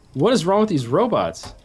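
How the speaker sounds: loud, high-pitched exasperation; exasperated outburst. Real, not acted. Player breaks off a story over broken robots, exasperated outburst.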